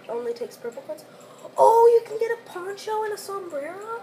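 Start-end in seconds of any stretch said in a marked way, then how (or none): 1.6-4.0 high, loud thrilled gasp; excited. Real, not acted. Spotting a sombrero for sale, high and excited. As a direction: sound delighted at the find. Finding ponchos in the shop.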